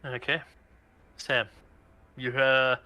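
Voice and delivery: ordinary talk, nothing marked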